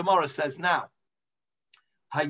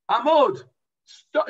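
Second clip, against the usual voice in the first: raised, louder one-word command; acted crowd demand; 7 semitones higher, 6 dB louder than usual. People interrupting the translator, a raised command. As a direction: call it out as the people's order. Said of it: "the people said to him".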